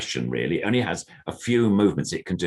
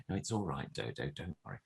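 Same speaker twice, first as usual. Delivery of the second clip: soothing the puppet; 3 semitones lower, 13 dB quieter, faster than usual. Pretend. Reassuring the puppet.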